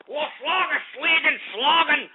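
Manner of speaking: high-pitched mock German accent, slowing on Volkswagen; a joking German accent aimed at German listeners. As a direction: bark out fake German words in a hammed-up German accent. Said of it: "for our German brethren".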